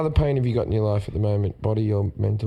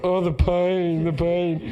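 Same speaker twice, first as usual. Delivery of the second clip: slow, higher-pitched hammed-up suffering; mock agony; 6 semitones higher, much slower than usual. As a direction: tease him with a fake lament over his aches. Mocking friend's claimed back pain.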